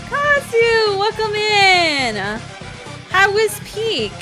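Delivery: high, bright streamer greeting; cute anime streamer. Real, not acted. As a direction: chirp hello to chat. Greeting chat arrivals.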